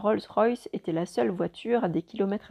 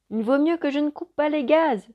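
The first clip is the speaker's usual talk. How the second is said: shouting over an engine; calling out loudly over noise; 6 semitones higher, 6 dB louder than usual. A narrator's reading. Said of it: "a-t-il crié par-dessus le rugissement".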